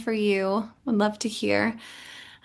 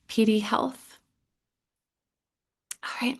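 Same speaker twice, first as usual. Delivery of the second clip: ordinary talk, nothing marked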